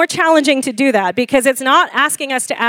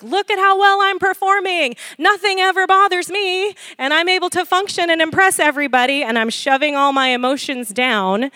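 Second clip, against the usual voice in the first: much higher, proud showing-off voice; boastful performer; 5 semitones higher than usual. Retold or imitated. Mocking Christians who perform composure for the church. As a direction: brag about never being bothered. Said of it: "It can look like".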